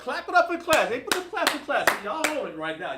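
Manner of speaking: loud, high cheer for the class; a real, joyful rallying cry; excited shout. Real, not acted. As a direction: pump the students up, calling out the praise. Hyping the class after right answers.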